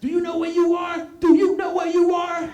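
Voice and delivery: high, strained repeated shout; hammed-up boyish yelling; teenage challenge. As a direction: challenge him at a high pitch, building. Acted boy escalating his challenge.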